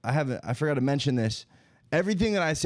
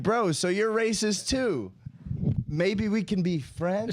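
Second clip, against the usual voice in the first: mock hopeful; 5 semitones higher, slower than usual.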